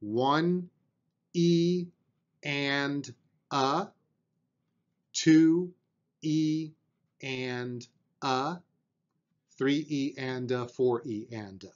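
each syllable counted in time, spaced out; counting out sixteenth notes. Shown as a demonstration. Drum teacher demonstrating sixteenth-note counting, steady and spaced. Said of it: "we're gonna count them like this".

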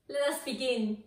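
ordinary delivery

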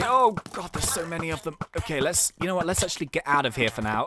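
ordinary delivery

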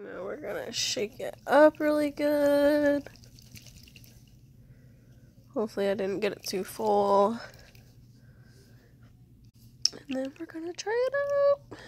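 congested sore-throat voice; sick voice. Real, not acted. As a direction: talk through a cold. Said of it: "excuse my sick ass voice".

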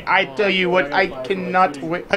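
lightly put-on Indian accent, unplanned; accidental Indian accent. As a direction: slide into an Indian accent without meaning to. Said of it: "Why am I having an Indian accent".

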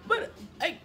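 high, stammering mock panic; hammed-up whimpering protest; fussy old-lady panic. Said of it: "acting like an old lady".

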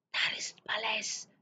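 put-on soft breathy whisper of a girl. Sophie whispering back to the giant, hushed. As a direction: whisper so the guards won't hear. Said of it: "fluisterde Sophie terug".

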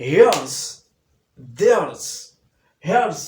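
loud "here's", "there's" ending in z; drilling the contractions loudly. Shown as a demonstration. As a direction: punch "here's" and "there's", finish on z. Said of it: "এর উচ্চারণটা জ".

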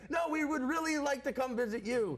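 high, over-eager mock pleading; insistent friend. Friends begging to visit anywhere but Buffalo, mock insistent.

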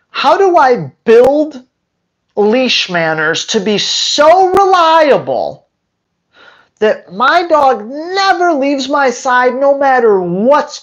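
spelled out slowly and deliberately; slow, emphatic question. Shown as a demonstration. Trainer modelling the question clients should ask, slow and emphatic. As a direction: draw out each phrase for emphasis.

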